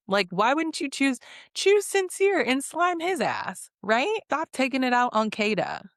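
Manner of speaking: high-pitched, indignant telling-off; worked-up retold scolding; exasperated scolding. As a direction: plead her case, pitch leaping up in disbelief. Scolding Mel for targeting Kada.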